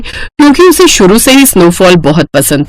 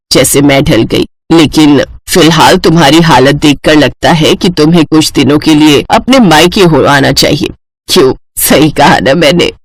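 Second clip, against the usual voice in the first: lower, gentle elder's voice; reassuring mother-in-law; 6 semitones lower than usual. Acted. Mother-in-law suggests bride visit her parents, reassuring. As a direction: comfort the tearful bride.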